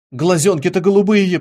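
plain reading voice

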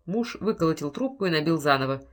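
usual speaking voice

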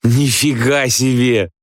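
high, slow drawn-out wonder; amazed. A narrator's reading. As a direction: stretch it out in drunken amazement. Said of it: "изумляется Чебыкин". (протяжное изумление высоким голосом)

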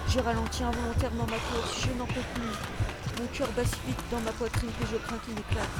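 narration tendue, voix plus haute; récit haletant de fuite. A narrator's reading. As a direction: raconter une course éperdue, dans l'urgence. Poursuite jusqu'à l'église. (récit d'action sous tension, ton élevé)

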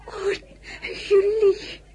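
high, laughing sob of relief; tearful relieved laughter; relieved laugh-cry. Acted. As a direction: melt with relief. Relief it is only Julie.

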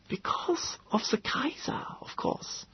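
high, breathy whisper, secretive; conspiratorial whisper. A narrator's reading. Gödel confides his paranoid secret in a whisper. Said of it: "like a conspirator's".

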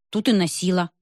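usual speaking voice

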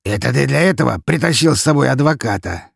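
scornful question with irony; ironic sneer. A narrator's reading. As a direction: jeer at the man's companion. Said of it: "усмехнулся толстяк, с иронией посмотрев на меня".

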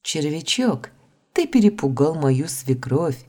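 ordinary delivery, nothing marked

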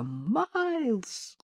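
high, drawn-out call, held back in volume; calling out loud. A narrator's reading. Owners searching for lost Miles, mock full-voiced cry. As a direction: call the name as if shouting from nearby. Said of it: "И совсем громко".

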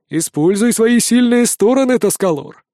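lightly voiced mentor; character voice. A narrator's reading. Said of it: "напоминает Сейнарай".

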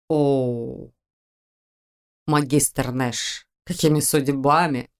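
put-on slow, slurred and low drunken voice; tipsy slurring, good-natured. A narrator's reading. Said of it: "Заплетающимся языком благодушно поинтересовался".

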